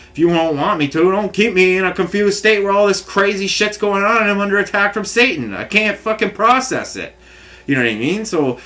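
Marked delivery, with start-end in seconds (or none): 0.1-7.1 much higher, strained pleading; exasperated plea. Retold or imitated. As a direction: push up into desperate frustration. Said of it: "and then I'm like".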